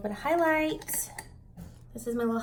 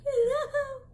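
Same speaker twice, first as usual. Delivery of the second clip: high, loud laughing wail; mock wail; 5 dB louder than usual. Devastated when I couldn't get.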